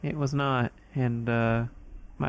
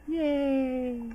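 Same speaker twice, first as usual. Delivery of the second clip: high mock cheer; 13 semitones higher than usual. Mock cheer after accent talk.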